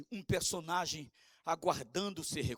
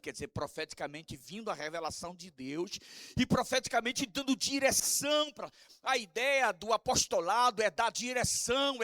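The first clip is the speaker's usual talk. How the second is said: usual speaking voice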